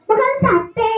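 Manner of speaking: high puppet echo. Acted. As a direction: repeat it as a silly little puppet. Puppet echoes in its voice.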